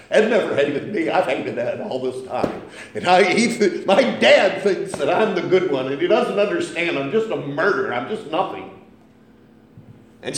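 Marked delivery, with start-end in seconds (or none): 0.1-8.7 loud, heated outpouring of self-blame; mock-anguished cry, pitched up and pushed; retold anguish. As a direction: retell a tormented confession with rising force. Retold anguished confession while weeping.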